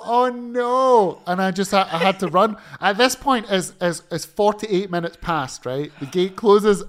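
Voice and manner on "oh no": mock panic. Panicked at the far gate.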